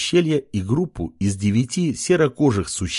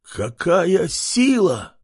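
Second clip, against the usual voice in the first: awed creature; 7 semitones higher, much slower than usual. A narrator's reading. Pig marvels at power.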